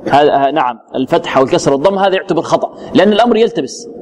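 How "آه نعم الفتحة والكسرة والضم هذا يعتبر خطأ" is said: usual speaking voice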